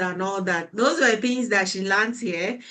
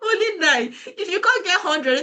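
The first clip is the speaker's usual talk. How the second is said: real laughter, pitched up high; laughing; 9 semitones higher than usual. Real, not acted. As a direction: laugh off the panic, teasing her. Laughing at a mentee's panic.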